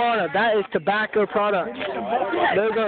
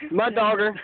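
usual speaking voice

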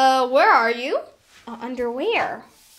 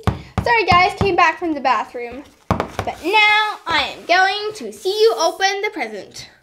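ordinary talk, nothing marked

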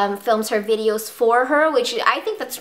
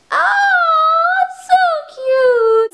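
very high, louder, slow gushing squeal; squealing coo; 6 dB louder, much slower than usual. Real, not acted. Vlogger squealing to viewers about her cute pet, high slow gush. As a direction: squeal over him, drawn right out.

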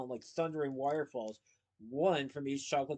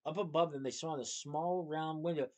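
ordinary delivery, nothing marked

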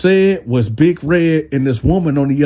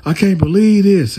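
raised, higher mock yell of disbelief; retold yelling; 7 semitones higher, 4 dB louder than usual. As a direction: yell it out in disbelief, half-acted. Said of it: "yelling at this point".

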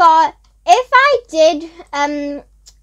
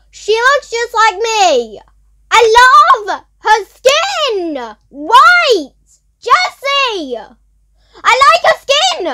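gushing; 6 semitones higher, 4 dB louder, much slower than usual. Real, not acted. Admiring her character.

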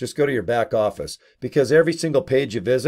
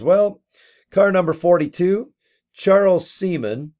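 higher, louder mock emcee call; announcer voice; 7 semitones higher, 4 dB louder than usual. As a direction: announce it like a car show emcee. [name] announces on a mic.

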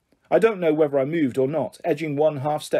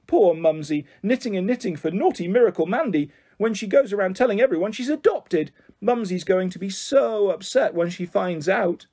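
higher, sing-song taunt; mocking pity; 5 semitones higher than usual. Bully taunts Mandy about her mum, mock pity. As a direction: pile on sugary fake sympathy.

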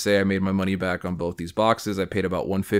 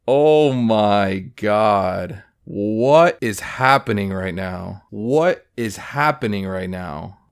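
slow and louder, in shock; stunned disbelief; 5 dB louder, much slower than usual. Real, not acted. Collector stunned by his rare pull, slow disbelief. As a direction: let the shock hang on each word.

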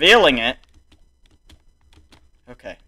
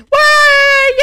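high, loud drawn-out wail; anguished shout; 12 dB louder than usual. Real, not acted. Player lamenting a loss, anguished shout. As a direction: howl the question at the game.

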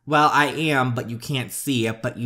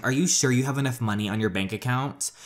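usual speaking voice

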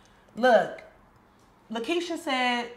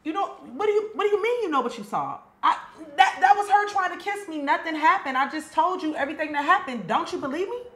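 upset pleading; 6 semitones higher, 3 dB louder, faster than usual. Acted. Pleading denial in a quarrel.

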